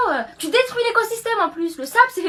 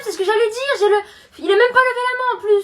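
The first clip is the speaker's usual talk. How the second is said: normal talking voice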